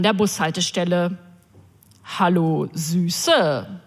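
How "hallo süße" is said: oily come-on. Retold or imitated. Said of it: "mit einem öligen Lächeln".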